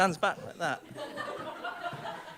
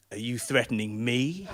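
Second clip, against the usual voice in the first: slow, lower, suspicious question; menacing echo; 5 semitones lower, slower than usual. Acted. Driver turning the question back on passenger, slow menace. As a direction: throw it back slowly as a threat. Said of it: "narrow his eyes at me and go".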